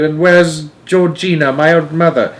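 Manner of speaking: high, loud, overwrought complaint; wailing. A narrator's reading. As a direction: pour out your distress in a wail. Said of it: "wailed Missus Bucket".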